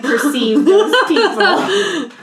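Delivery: normal talking voice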